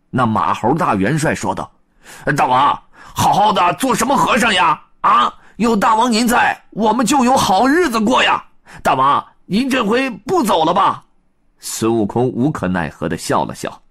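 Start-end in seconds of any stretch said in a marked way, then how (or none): 2.4-11.0 high, loud monkey coaxing; high monkey marshal, coaxing; pleading monkey marshal. Acted. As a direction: beg the king to stay.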